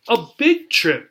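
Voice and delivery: usual speaking voice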